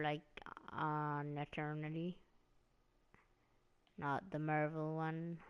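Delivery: usual speaking voice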